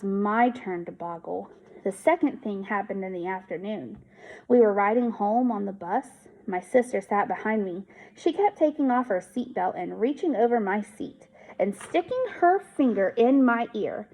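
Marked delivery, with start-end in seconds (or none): none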